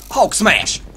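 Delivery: loud, punchy battle cry; shouted game move. Pretend. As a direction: call out the attack at full volume.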